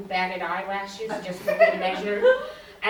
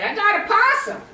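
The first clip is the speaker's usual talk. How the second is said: high, loud, proud news; retold excitement, pitch and volume jumping up; excited announcement; 10 semitones higher, 6 dB louder than usual. Wife telling her husband about the trap, excitedly.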